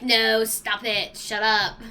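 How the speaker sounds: slow, loud telling-off to the cats; hammed-up stern owner; mock stern scolding. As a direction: scold the cats slowly and loudly. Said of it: "I'm like".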